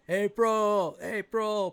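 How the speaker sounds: high, drawn-out loud call; shouting her name. A narrator's reading. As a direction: holler for a missing friend. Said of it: "He just starts shouting her name".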